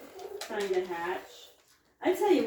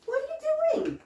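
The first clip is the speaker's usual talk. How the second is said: much higher squeaky question to the goat; high pet voice. Real, not acted. To a goat messing around, very high pet talk. Goat doing something odd.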